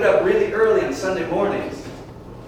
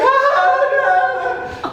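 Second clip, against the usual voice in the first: high, loud, hammed-up sung wail; mock wail; 7 dB louder than usual. Mom and Joe crying over the enlistment, mock wail. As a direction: cry it out in mock despair. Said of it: "My mom and Joe just cried like".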